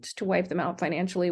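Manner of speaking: ordinary talk, nothing marked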